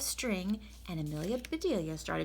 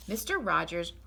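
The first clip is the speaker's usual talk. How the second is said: plain reading voice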